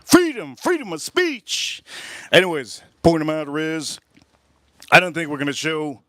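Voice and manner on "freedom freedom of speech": mock proclamation. Mock proclaiming free speech.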